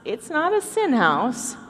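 ordinary talk, nothing marked

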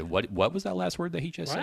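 high-pitched baffled disciple; playing stunned confusion; incredulous. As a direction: ask it in mock disbelief, voice climbing. Acting out the disciples' disbelief.

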